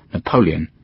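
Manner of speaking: plain reading voice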